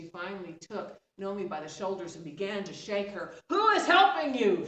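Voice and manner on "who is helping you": much higher and louder furious yell. Acted. Stepmother shaking Nomi, yelling furiously. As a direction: scream it in her face. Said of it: "she yelled".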